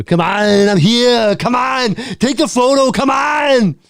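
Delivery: high, building to a shouted Austrian Arnold; hammed-up Austrian Arnold rising to a shout; Arnold impression. Host pushing Arnold's photo demand, Austrian accent rising to a shout. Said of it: "I can't do an Arnold impression".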